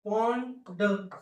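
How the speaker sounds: teacher sounding out pond slowly; drawn-out word. Shown as a demonstration. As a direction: stretch pond slowly, each sound clear. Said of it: "संपला शब्द".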